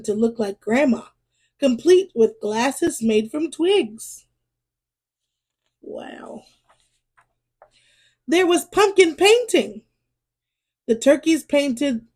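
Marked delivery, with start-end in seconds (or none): 5.8-6.4 breathless whispered wow. Acted. Reader marvels at the carved pumpkin, whispering. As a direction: hush it, all air and wonder.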